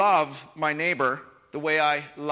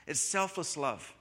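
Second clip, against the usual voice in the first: ordinary talk, nothing marked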